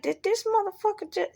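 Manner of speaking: high-pitched mock disbelief; incredulous inner voice. Your mind reacting to what it heard, high and incredulous. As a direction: pitch it way up, scandalized. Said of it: "your mind be like".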